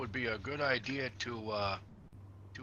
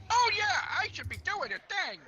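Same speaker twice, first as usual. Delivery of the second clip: high mock voice; 3 dB louder, faster than usual. Quoting self in a high voice.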